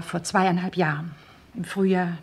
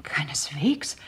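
whispered; 5 semitones lower, 6 dB quieter, slower than usual. Acted. Firm denial in an interrogation.

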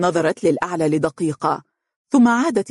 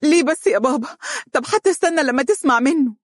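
much higher, pleading cry; high distressed plea; tearful plea; 10 semitones higher than usual. A narrator's reading. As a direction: beg the father through tears. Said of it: "انسابت عبراتها وهي تهتف".

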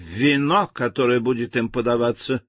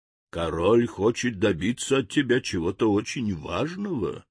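plain reading voice

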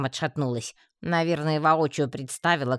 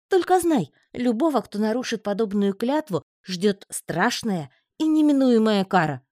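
громко, медленно, угрожающе; пригрози страшной карой; грозное предупреждение; 7 semitones higher, 5 dB louder than usual. A narrator's reading. Амазонка грозит карой. (амазонка предупреждает паучка: громкое зловещее предупреждение)

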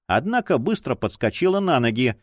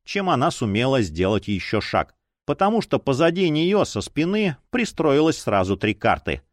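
plain reading voice

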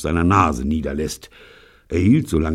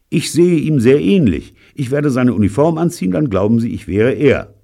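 high, loud and excited; eager proposal; 10 semitones higher, 7 dB louder than usual. A narrator's reading. Fritz proposes a disguise to his friends, excited. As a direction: pitch your bright idea loudly to the group.

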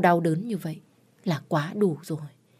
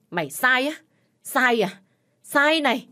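high, mocking furious questions; angry taunting; 6 semitones higher, 4 dB louder than usual. A narrator's reading. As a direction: throw the word back at them, rising in anger. Father beats and taunts daughter. (giận dữ vặn lại, giọng cao gắt)

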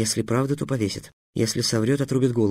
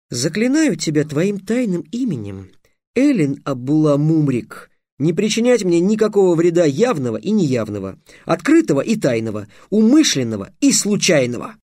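higher, louder, ceremonial incantation; solemn spell; 6 semitones higher, 4 dB louder than usual. A narrator's reading. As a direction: pronounce the binding words formally. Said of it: "торжественно сказал Трикс". (торжественное заклинание)

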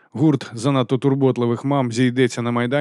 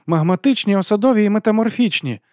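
high-voiced mocking correction; 10 semitones higher, 4 dB louder than usual. A narrator's reading. Abby correcting a classmate's quiz answer, mildly mocking. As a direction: answer high, with a touch of mockery. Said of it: "відповіла Еббі насмішкувато".

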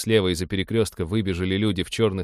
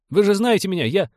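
high, raised appeal, breaking off; alarmed, higher-pitched protest; urgent pleading; 12 semitones higher, 5 dB louder than usual. A narrator's reading. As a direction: plead to be recognised. Captain pleads with armed men.

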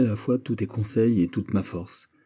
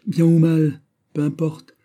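passionate outburst; 8 semitones higher, 6 dB louder, slower than usual. Acted.

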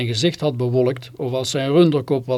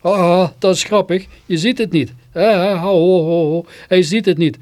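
higher, merry guffaws between the words; acted laughter; 4 semitones higher, 4 dB louder than usual. A narrator's reading. Amused father laughing as narrator misses the joke, acted laughter. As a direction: laugh helplessly at someone who misses the joke. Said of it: "en vervolgens die lach".

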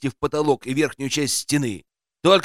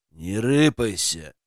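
gruff bark; 6 semitones lower, slower than usual. A narrator's reading. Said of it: "рявкнули сзади".

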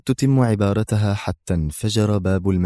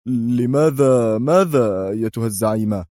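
tense questioning. A narrator's reading. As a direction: ask the boss uneasily. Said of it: "في قوة وتوتر وهو يتساءل".

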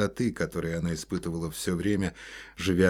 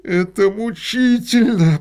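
slow, strained, much higher and louder; through gritted teeth; 12 dB louder, slower than usual. A narrator's reading. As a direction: force it out through clenched teeth. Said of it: "процедил сквозь зубы художник".

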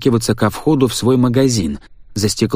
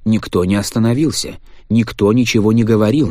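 ordinary delivery

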